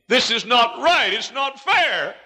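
a son protests at his father's casket; anguished protest. Retold or imitated. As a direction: hurl it upward in grief. Angry prayer at father's casket.

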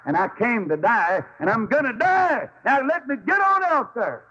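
preacher voicing Jesus' resolve to die; preaching at full force. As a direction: climb to a triumphant cry.

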